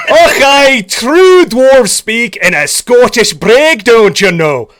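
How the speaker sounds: loud, high-pitched Scottish brogue; big, slow-rolling Scottish dwarf boast. Pretend. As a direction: belt it out as a hammed-up Scottish dwarf. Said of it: "do southern dwarves and Scottish dwarves".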